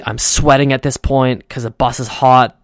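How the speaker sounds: ordinary talk, nothing marked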